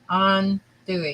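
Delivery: slow, careful AHN-too-ee for andouille; Cajun andouille. Shown as a demonstration. Correcting her friend, slowly saying andouille. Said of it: "It's andouille".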